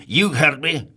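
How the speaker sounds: loud grumpy snap; snapped. Acted. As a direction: snap it back at him. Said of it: "snapped Mister Grumpy".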